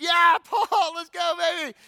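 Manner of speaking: high, loud hype shout; hyped cheer. Retold or imitated. Church members hyping Paul's letter, loud high cheer. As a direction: cheer him on like a crowd.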